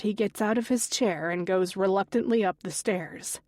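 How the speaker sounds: ordinary delivery, nothing marked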